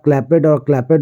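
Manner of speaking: "क्लैपेड" split as clap-ed; wrong pronunciation. Shown as a demonstration. Teacher warning students about clapped, wrong two-syllable pronunciation. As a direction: sound the e fully in "क्लैपेड". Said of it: "ये आपका wrong होगा, गलत होगा".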